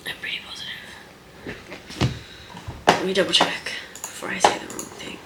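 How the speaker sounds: soft unsure whisper, trailing off; whispered aside. Real, not acted. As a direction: mutter the doubt under your breath. Doubting herself, checking facts.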